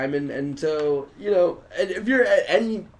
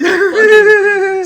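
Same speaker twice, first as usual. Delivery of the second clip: high loud exasperated cry; exasperated yell; 14 semitones higher, 12 dB louder than usual. Real, not acted. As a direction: let it burst out high. Exasperated outburst.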